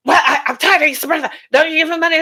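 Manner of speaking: wife fed up with the husband's brother, mock exasperated; mock exasperation. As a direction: snap at the spouse about the brother. Acted spouse complaining about brother.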